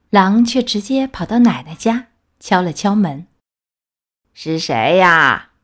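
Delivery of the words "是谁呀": put-on lower, slow old woman's voice; frail grandma. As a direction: call out like a weak old granny. Grandma answers the door. (奶奶虚弱地问，声音低沉缓慢)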